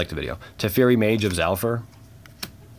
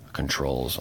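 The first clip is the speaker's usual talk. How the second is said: normal talking voice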